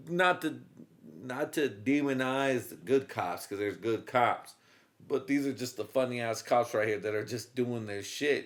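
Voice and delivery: ordinary talk, nothing marked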